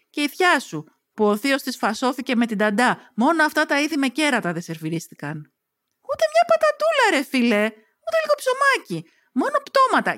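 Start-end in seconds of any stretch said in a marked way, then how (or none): 6.1-10.2 much higher, whining protest; high-pitched moan about only meat on the table; whiny complaint.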